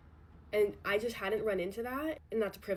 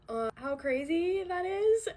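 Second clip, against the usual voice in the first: high, loud, slow disbelief into a laugh; nervous real laughter; 9 semitones higher, 4 dB louder, slower than usual. Real, not acted. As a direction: laugh it off over something painful. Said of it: "I don't know why I'm laughing".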